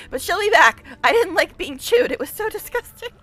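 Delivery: loud, high petulant wail; offended demon queen complaining, loud and whiny. A narrator's reading. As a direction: throw a spoiled tantrum. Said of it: "says in a whiny, petulant voice".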